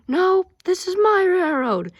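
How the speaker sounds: hammed-up squabble stressing "my"; mock quarrel. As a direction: insist the railroad is yours. Mock quarrel over railroad.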